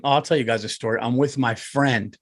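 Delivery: ordinary talk, nothing marked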